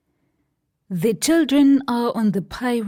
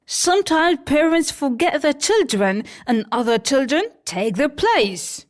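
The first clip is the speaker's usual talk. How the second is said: put-on higher, quick boyish voice; boyish Peter Pan; 6 semitones higher, 3 dB louder than usual. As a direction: rattle it off like a cocky boy.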